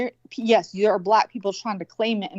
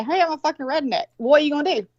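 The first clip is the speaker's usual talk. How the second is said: mock announcement, pitched up; claiming it first; 6 semitones higher, faster than usual. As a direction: play a breezy self-introduction, higher than usual. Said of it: "I claim it".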